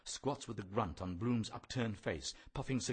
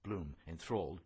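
ordinary talk, nothing marked

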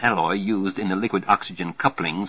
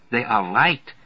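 put-on higher German-accented secretary; 4 semitones higher, slower than usual. A narrator's reading. Secretary describing her glasses, German accent. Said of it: "German accent, all right".

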